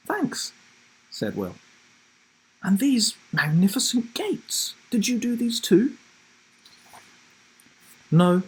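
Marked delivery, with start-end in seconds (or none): 0.1-0.5 put-on boy's thanks, pitched high; a shy child thanking granny; young Will. Will voiced in story. 2.6-6.0 a grandmother lingering over the magnificent gates; admiring grandma. Acted. 8.1-8.4 put-on higher, louder boy's denial; a boy quickly denying the gates; Will's quick no. Will answering grandma.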